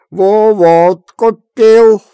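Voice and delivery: put-on very slow, high, loud drunk brag; drunken boast. A narrator's reading. Kuzma brags to Efrem, slow loud drunk voice. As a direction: drag out the brag.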